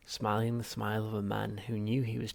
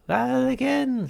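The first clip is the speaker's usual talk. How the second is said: much higher, louder, drawn-out shrink; Halfrunt voice; 11 dB louder, much slower than usual. Acted. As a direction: stretch it out smugly, slowly. Psychiatrist voiced.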